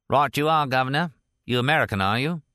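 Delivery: a chirpy London driver over the intercom; lightly voiced cockney taxi driver; London cabbie.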